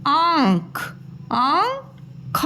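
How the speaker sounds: आँख with the vowel through the nose, second take drawn out very slowly; आँख, nasalized; nasal आँख. Shown as a demonstration. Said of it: "नाक से निकलती है".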